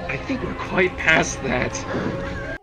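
slow, drawn-out whisper. Acted. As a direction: whisper it slowly, heavy with meaning. Spider answers in a hushed, slow voice.

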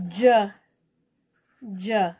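"J" as a short "juh", each one separate; letter sound. Shown as a demonstration. Teacher searching the page for J's, each "J" sounded out as juh. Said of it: "sound out this letter J sound".

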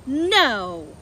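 loud, drawn-out disbelief; astonished. A narrator's reading. Badger hearing about the chicken house, astonished. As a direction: exclaim in sheer amazement.

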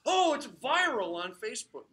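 loud, high, drawn-out fake hype; mock excitement. Speaker mocking people who share a celebrity's clip, overexcited. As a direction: blare it in exaggerated awe.

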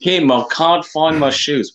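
high, loud, drawn-out mock New Zealand accent; slow, shrieky attempt at a New Zealand accent; bad New Zealand accent. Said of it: "that was a terrible, um, New Zealand accent".

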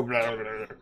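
rattling, juddering electric-shock noise; imitating being electrified. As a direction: mimic someone being zapped. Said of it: "The sound people make when being electrified".

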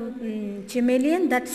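plain reading voice

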